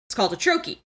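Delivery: ordinary talk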